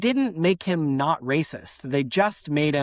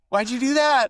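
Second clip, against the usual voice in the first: high, louder mock outburst; exasperated self-scolding; 8 semitones higher, 4 dB louder than usual. Speaker berating themselves for copying an accent, exasperated. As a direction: burst out at yourself in mock dismay. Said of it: "turn around and I'll be like".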